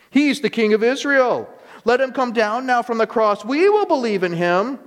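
high, loud sarcastic jeer; loud mocking. A narrator's reading. Chief priests mocking Jesus, loud high sneer. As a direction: shout the scorn up at him. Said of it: "mocked him".

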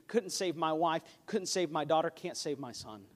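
usual speaking voice

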